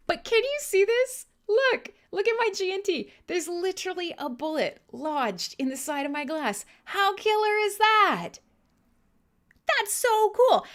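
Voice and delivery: high-pitched show-and-tell excitement; thrilled, voice riding high; very excited. Real, not acted. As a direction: gush over a cool new gift. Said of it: "I'm very excited to show it".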